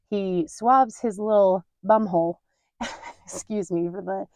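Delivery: ordinary delivery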